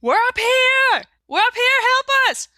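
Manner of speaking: acted scream, high and carrying; screaming for help. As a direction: call out to distant rescuers at the top of your voice. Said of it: "I start screaming as loud as I can".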